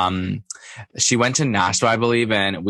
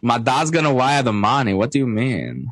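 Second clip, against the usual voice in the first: imitation of an affected European-socialite accent, slightly raised pitch; Anna Delvey accent; 3 semitones higher than usual. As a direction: mimic the fake-heiress accent, playing it up. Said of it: "Kenny had this Anna Delvey accent".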